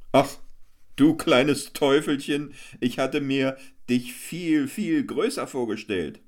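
high, boisterous clown hamming; Hans Wurst the clown. A narrator's reading. Hans Wurst boasts to the crowd, high and boisterous. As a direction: work the crowd like a fairground clown.

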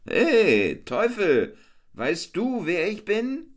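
high, boisterous clown hamming; Hans Wurst the clown. A narrator's reading. Hans Wurst boasts to the crowd, high and boisterous. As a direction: work the crowd like a fairground clown.

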